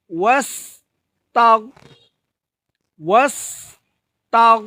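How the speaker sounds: ওয়াস্তাগ with the গ stopped and held, no bounce; held consonant. Shown as a demonstration. Teacher shows the held গ at the end of ওয়াস্তাগ. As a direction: close off the গ in ওয়াস্তাগ and hold it. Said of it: "তাহলে".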